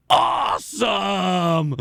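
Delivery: very slow, high drawn-out shout; kid's awe. Retold or imitated. Teen fan getting free tickets: slow high awestruck shout. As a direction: stretch the word like a thrilled teen. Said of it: "he goes".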